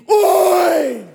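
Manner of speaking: high, loud call of oi; neighbour's shout. Retold or imitated. Neighbour shouting at her over the fence, loud call. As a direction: yell across the fence to stop someone.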